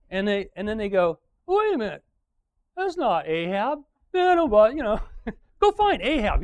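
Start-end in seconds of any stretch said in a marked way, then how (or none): 1.5-6.4 high, loud mock double-take; surprised realisation. Aram soldiers realising it is not Ahab, high mock surprise. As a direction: catch yourself mid-charge.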